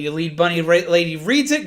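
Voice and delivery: ordinary talk, nothing marked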